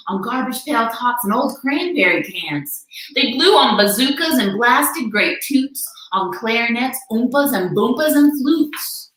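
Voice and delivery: ordinary delivery, nothing marked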